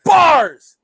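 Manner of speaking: loud, high burst of approval; hype shout. Real, not acted. Co-host hyping the comedian's rhyme, shouted high.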